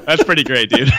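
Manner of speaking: amused, said through laughter; laughing. Real, not acted. Host teasing cohost over his rankings, laughing.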